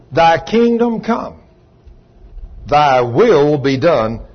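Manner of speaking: slow, solemn scripture reading; solemn Lord's Prayer recital; prayer recitation. Acted.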